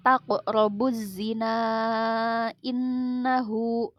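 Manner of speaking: taqrobu zina innahu recited slowly with tajwid, vowels drawn out; tajwid recitation. Shown as a demonstration. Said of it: "pada lafal". (taqrobu zina innahu dilantunkan pelan sesuai tajwid)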